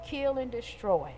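normal talking voice

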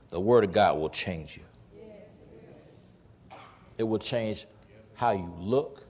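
ordinary delivery

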